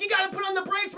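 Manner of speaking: high-pitched urgent plea; mock panicked Luigi. Luigi heading for the cliff, high mock alarm.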